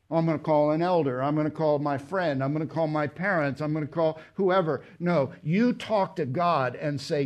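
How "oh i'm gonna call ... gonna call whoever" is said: quick mock reply.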